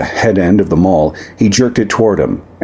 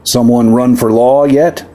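raised voice; 5 semitones higher than usual. Acted. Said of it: "raised his voice".

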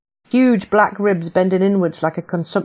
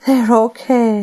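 higher, louder, tumbling with relief; relieved outburst; 5 semitones higher, 5 dB louder, slower than usual. Acted. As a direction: let the relief spill out. Relief on hearing boys safe.

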